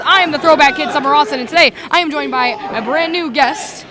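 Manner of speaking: loud ring announcer intro; big booming show opener. Acted.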